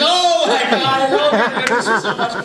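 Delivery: loud, high-pitched hammed-up wail; shouted, over-the-top sobbing imitation; mock bawling. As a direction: lose it completely in a comic crying fit. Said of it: "And she starts crying".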